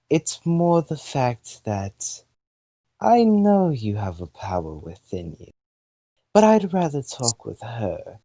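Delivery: slow, haughty British female voice; measured British goddess, drawing the words out; British accent. Acted. Fire goddess coolly tells the human her dislike, haughty British accent. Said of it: "giving her a British accent".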